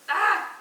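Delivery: short, high, loud cry of frustration; frustrated yelp. Real, not acted. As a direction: let out a sudden exasperated "ah". Can't cope with how show ends.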